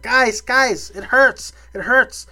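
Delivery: put-on high, whining ten-year-old complaining; mock-whine loudly in a childish high pitch; hammed-up pleading little-kid whimper; Tobey Maguire voice. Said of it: "that's my Tobey Maguire voice".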